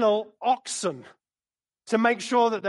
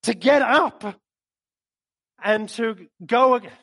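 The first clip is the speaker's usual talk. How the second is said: voice of the Lord; 3 semitones higher, 6 dB louder, slower than usual. Acted. The Lord to a pinned-down Paul, slow and loud. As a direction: say it slowly and loud, like a weight. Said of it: "and the Lord is saying".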